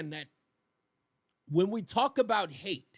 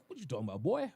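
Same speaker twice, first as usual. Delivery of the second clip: street voice; 3 semitones lower, 4 dB quieter, much faster than usual. Retold or imitated. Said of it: "I'm just like".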